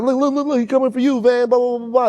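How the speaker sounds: high, frantic calls about a bee; panicked yelling. Retold or imitated. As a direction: shriek a warning to a friend. Panic over bee in room.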